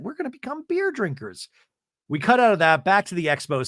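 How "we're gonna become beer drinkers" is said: high squealy repeat; mock Angie excitement. Angie marvelling to the group, high giddy voice. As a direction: pitch it up in giddy disbelief. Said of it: "And Angie goes".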